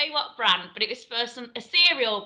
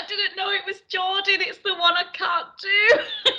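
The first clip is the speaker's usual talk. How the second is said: high-pitched, mock-wailing excuse; helpless protest; 6 semitones higher than usual. Actor excusing herself to casting team, mock-helpless wail. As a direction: plead helplessly, pitched up, half laughing at yourself.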